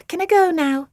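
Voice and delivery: bored and impatient; sighed. A narrator's reading. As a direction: Asti wanting to leave. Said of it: "sighed Asti".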